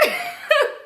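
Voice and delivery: quick high airy laugh; high breathy laugh. Real, not acted. Narrator laughing at the drunk girl, high airy laugh. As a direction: burst out laughing at it.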